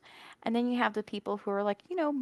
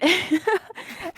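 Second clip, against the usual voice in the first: high laugh; 11 semitones higher, 6 dB louder than usual. Real, not acted. Laughing after mock wail.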